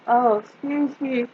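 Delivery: yawning through the words. Real, not acted. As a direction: talk mid-yawn. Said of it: "I apologize for all the yawning".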